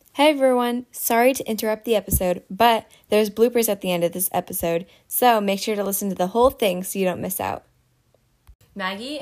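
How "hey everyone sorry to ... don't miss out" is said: loud, bright podcast announcement. Acted. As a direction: cut in like a recorded promo. Inserted announcement to listeners.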